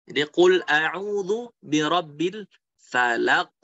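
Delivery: slow, careful recitation of kul a'udhu birabbil falaq; slow Quranic recitation. Shown as a demonstration. Teacher reciting the verse in slow tajwid. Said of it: "reciting the verse slowly".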